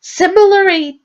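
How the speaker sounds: Similarly as a strained, breaking squeak; high, cracking squeak. Shown as a demonstration. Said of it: "the way I said it, to the way, um, I felt".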